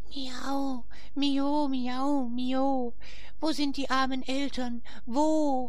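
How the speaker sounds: very high, mournful cat voice; weeping cats. A narrator's reading. The little cats weeping over the ashes, high mournful cat voice. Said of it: "die sitzen da und weinen".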